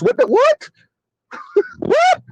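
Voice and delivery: squeaky high disbelief; a shocked, high-pitched what; incredulous. Real, not acted. Incredulous reaction.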